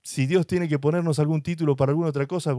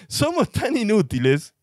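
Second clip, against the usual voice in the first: mock exasperation; 8 semitones higher than usual. Preacher mocks human weakness.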